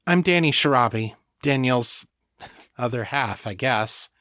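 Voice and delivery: ordinary delivery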